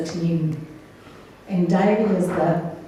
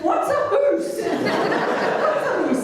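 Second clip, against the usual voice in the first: mocking "hoose" pronounced like hoos, higher and laughing; mock Welsh accent; 11 semitones higher, slower than usual. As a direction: repeat "hoose" as a teasing Welsh accent, slow. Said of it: "his Welsh accent".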